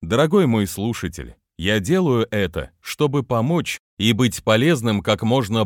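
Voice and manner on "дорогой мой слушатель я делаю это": plain reading voice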